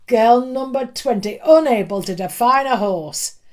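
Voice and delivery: loud, lecturing the whole class; teacher declaiming to the class; booming schoolmaster. Acted. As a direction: shame her before everyone. Schoolmaster scolds before class.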